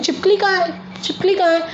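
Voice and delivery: high, playful questioning; asking a child. Retold or imitated. Mother asks her little daughter where the lizard is, in a high coaxing voice. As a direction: ask like a mother coaxing a toddler. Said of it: "मैं इसको पूछती हूँ".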